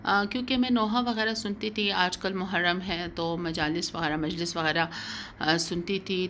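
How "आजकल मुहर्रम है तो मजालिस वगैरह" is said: usual speaking voice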